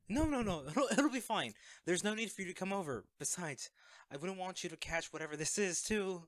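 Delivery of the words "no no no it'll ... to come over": high, loud hurried no's with a nervous laugh; nervously brushing off a friend who wants to visit; flustered denial. Acted. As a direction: laugh it off in a panic. Panicked refusal of friend visiting.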